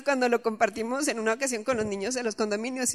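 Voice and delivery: ordinary talk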